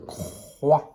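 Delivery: "croix" drawn out on its own; isolated syllable. Shown as a demonstration. Teacher giving the answer to pupils, syllable "croix" stretched out. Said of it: "on entend la syllabe".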